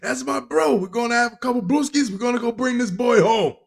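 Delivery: high-pitched mock Long Island dad; put-on Long Island accent. Father defending his bounty-hunting buddy, mock Long Island accent. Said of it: "that's the accent for a Long Island family".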